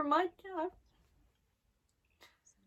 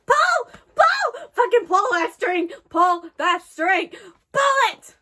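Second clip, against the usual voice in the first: urgent shouting; 3 semitones higher, 7 dB louder than usual. Real, not acted. Viewer urging the hero to pull the string, urgent shouting. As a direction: yell it like an urgent order.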